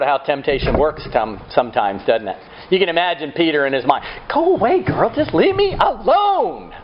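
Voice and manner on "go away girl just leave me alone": Peter's exasperated plea. Retold or imitated. Peter in his mind begging the girl to leave, high exasperated. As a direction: push her away with your voice.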